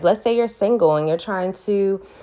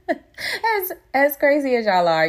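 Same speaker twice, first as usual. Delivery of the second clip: laughing; 10 semitones higher than usual. Real, not acted. Teasing Scorpios, laugh heard.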